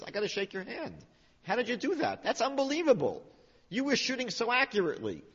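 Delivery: high-pitched, marvelling praise; amazed admiration. Acted. Wanderer congratulates the archer, in high amazed admiration. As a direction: gush in astonishment, pitched well up.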